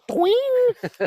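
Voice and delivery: high, drawn-out loud "twing"; imitated spring twang. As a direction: mimic a bedspring going off. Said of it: "I hear the springs go".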